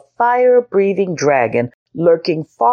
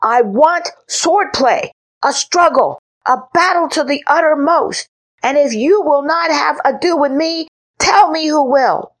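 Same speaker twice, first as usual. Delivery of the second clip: little knight demanding a real fight; high boy knight demanding; 11 semitones higher than usual. A narrator's reading. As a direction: plead for battle like a stubborn child. Henry demands a battle.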